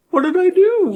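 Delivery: very high, confused whine; whiny kid. Acted. Greg protests to the angry dad, high confused whine. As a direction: squeak it like a startled little kid.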